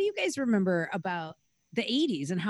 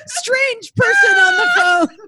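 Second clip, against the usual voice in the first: mock panicked shout; 11 dB louder than usual. Listener playing child warning of stranger caller, mock panic. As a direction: shout a hammed-up warning over the laughter.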